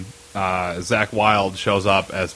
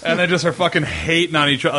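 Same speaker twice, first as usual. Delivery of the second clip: ordinary delivery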